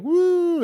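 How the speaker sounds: high-pitched pretend "woo"; imitated audience whoop; mock crowd cheer. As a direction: whoop it like an easily wowed crowd. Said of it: "and they're all like".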